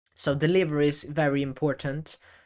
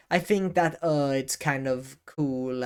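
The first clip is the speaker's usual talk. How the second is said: slow, hesitant filler-laden take; slow hesitant demo; slower than usual. Shown as a demonstration. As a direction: drag it out with an uh. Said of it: "instead of just".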